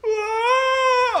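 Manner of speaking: very high loud panicked scream; a buddy reacting to a crying customer, with a very high panicked shriek; paranoid shriek. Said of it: "the paranoid sound".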